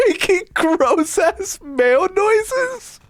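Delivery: slow, sky-high squeal of disbelief; drawn-out, very high-pitched horrified amusement; incredulous squeal. Real, not acted. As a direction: repeat it aghast, cracking up. Repeats the line in laughing disbelief.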